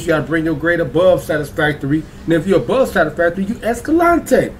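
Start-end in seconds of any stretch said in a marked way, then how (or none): 3.4-4.5 high, slow cartoon explorer voice; Dora the Explorer. Host explaining his grades, in a high cartoon explorer voice. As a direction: draw it out high like a kids' show heroine. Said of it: "my door Explorer voice".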